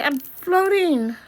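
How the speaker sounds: high, slow, awestruck; high and slow, marvelling at floating; amazed delight. Acted. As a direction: savour the wonder of floating. Discovering he is floating.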